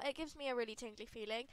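usual speaking voice

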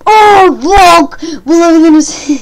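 sudden high yelp of surprise; surprised shout. Real, not acted. Gamer shocked the game guessed right, loud yelp. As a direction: blurt it out, caught off guard.

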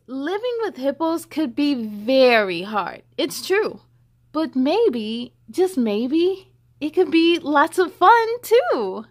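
put-on lively read-aloud to children; story reading. A narrator's reading. Reading the hippo book to children, lively storybook voice. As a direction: read it bright and sing-song for kids.

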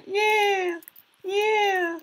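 very high, loud cheer; squealing yeah. Real, not acted. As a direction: shriek the win. Celebrating correct answer.

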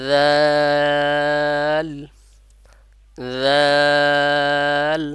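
long held jal, loud and stretched for three alif; teacher stretches jal slowly for students to copy; drawn-out demo of jal. Shown as a demonstration. Teacher demonstrates the letter for students, long and loud. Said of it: "আমার সাথে সাথে চেষ্টা করুন".

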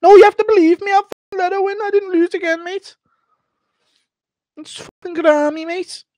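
squeaky defensive insistence; Darren Till excuse. Retold or imitated. Darren Till insists he let his daughter win, squeaky whine. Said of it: "Darren Till explaining".

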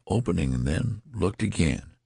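usual speaking voice

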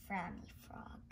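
softly whispered accusation. Real, not acted. Kid whispering blame on the frowny frog. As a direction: hush it like a secret.

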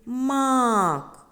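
emphatic sounding-out of "мак", vowel sustained; drawn-out vowel. Shown as a demonstration. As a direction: stretch the а in "мак". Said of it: "В слові".

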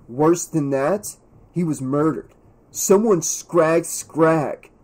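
relishing the gossip, slowly; understated glee, taking its time; gleeful. A narrator's reading. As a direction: draw out the juicy news. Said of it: "could not hide the glee in his voice".

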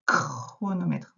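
chronomètre slow, with the opening "kr" drawn out; first sound emphasized. Shown as a demonstration. Teacher showing the first sound, slow emphatic chronomètre. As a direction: stretch the start of chronomètre so the K stands out. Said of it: "On entend le K d'abord".

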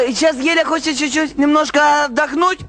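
ordinary delivery

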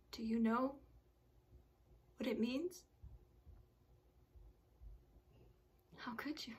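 hushed, near-whispered grief; soft, halting words with long pauses; grieving patient. Acted. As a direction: confront the doctor barely above a whisper. Patient recalls lost children to doctor.

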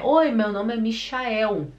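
"Michael" as mi-sha-EU; Brazilianized pronunciation. Acted. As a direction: introduce "Michael" with SH and final U glide. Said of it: "Mas se ele chega e fala".

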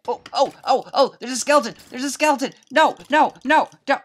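high, loud panicked cries. Real, not acted. Gamer panicking as a skeleton comes at her. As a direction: scream it as the skeleton closes in.

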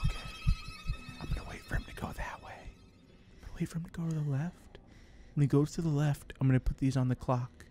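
very soft, low undertone; hushed whisper. Real, not acted. Player waiting for him to go left, hushed. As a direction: murmur it so nobody hears. Hiding, waiting for enemy to pass.

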